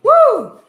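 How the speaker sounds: high, loud laugh and whoop. Real, not acted. Loud whoop about the heat.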